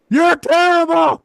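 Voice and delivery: high, slow, shouted heckle; heckler yelling. Retold or imitated. Heckler in the crowd yelling at the comic. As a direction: bellow it up at the stage. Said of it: "he just yelled up".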